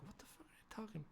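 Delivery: hushed fast whisper; quick unvoiced whisper. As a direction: whisper it under your breath. Sneaking past the crowd.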